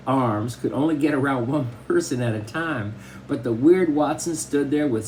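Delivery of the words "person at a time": ordinary delivery, nothing marked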